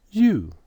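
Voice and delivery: U said high and loud, you; modelling U. Shown as a demonstration. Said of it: "How do you pronounce U?".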